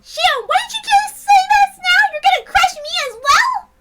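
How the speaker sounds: shocked protest. Acted. Shocked at betrayal.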